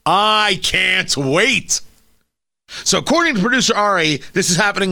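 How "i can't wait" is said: drawn-out mock eagerness; sarcastic. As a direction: stretch it slowly, dripping irony. Sarcastic after the Cuomo rant.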